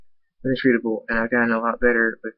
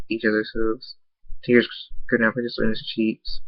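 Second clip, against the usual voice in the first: usual speaking voice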